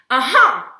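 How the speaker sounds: usual speaking voice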